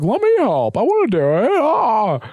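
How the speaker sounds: high-pitched mock kid voice, stretched into a yelp; overeager kid. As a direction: mimic an eager kid butting in, way up high. Mimicking eager kid butting in.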